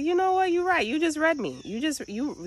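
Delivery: high-pitched, emphatic revelation; squealed, amazed concession; stunned agreement. Retold or imitated. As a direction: act out being totally seen through. Retold reply, overcome by being read.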